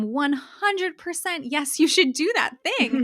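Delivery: high, drawn-out, emphatic yes; emphatic encouragement. Retold or imitated. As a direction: cheer her on with total conviction. Acting out emphatic encouragement to callers.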